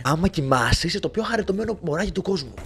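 soft cooing. Real, not acted. Player cooing at cute sleeping baby, soft baby talk.

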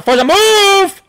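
stretched, high shout; drawn-out yell. Real, not acted. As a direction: yell slowly at a teammate. Yelling at slow teammate.

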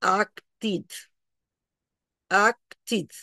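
"acted" in two separated syllables; "acted" ending in D. Shown as a demonstration. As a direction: split "acted" into ak-tid. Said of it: "Con D".

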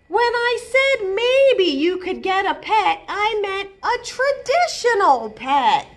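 a mother explaining patiently but fed up; exasperated mom. Acted. Mom scolding Izzy about her octopus pet, exasperated.

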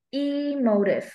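"Emotive" very slow with a long, high E; first-syllable stress. Shown as a demonstration. Teacher offering stress options to a student, slow E-stressed "Emotive". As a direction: stretch EEE-motive, falling after the E. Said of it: "stress vào âm mấy".

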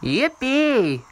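high little yippee; gleeful cheer. Real, not acted. Gamer cheering a position gain, gleeful. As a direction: squeal it out happily.

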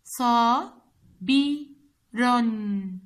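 صا، بي، رن split into slow separate beats; slow, loud syllable drill. Shown as a demonstration. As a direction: spell out صابر one beat at a time. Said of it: "تحليل كلمة صابر هو".

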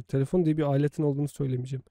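usual speaking voice

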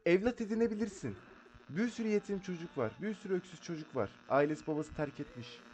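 high, loud hammed-up comforting; mock soothing. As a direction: play the hammy big sister soothing a child. Comforting crying ghost child, loud.

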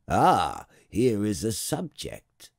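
slow, grand exclamation; exclaiming king. A narrator's reading. King greets the arriving little prince, exclaiming grandly. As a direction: hail a new subject with royal relish. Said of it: "exclaimed the king".

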